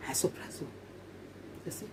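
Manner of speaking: unvoiced soft whisper. Real, not acted. Teasing viewers about her surprise, whispered. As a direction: hush it to the viewers. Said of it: "whispered aside".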